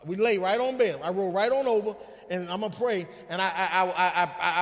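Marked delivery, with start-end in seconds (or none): none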